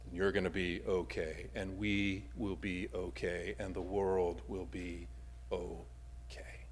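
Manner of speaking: calm yourself, trailing into a whisper; reassuring self-talk fading to a whisper. Acted. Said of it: "allows us to say to ourselves".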